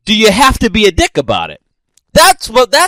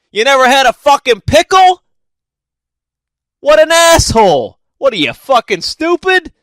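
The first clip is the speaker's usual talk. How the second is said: mock furious rant; 6 semitones higher than usual. Imagined sandwich worker yelling at a first-time customer, hammed-up fury. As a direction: blow up at the customer in mock outrage.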